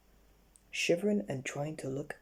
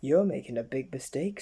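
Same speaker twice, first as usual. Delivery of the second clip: worm warning; 5 dB louder than usual. Acted. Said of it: "said the worm".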